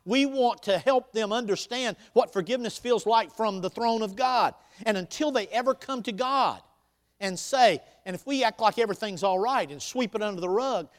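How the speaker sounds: loud, high, driving preacher voice; booming motivational speech. Acted. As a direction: preach it out to the room. Preaching at full force.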